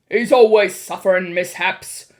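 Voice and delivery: loud, booming complaint; gruffly dismissive, at full volume; blustering father. A narrator's reading. Mr. Jenkins complains about his son, loudly blustering.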